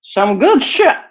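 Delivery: squeaked out high after a hit; strained high voice holding smoke; squeaky high praise. Real, not acted.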